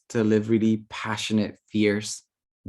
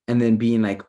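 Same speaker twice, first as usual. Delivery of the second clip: normal talking voice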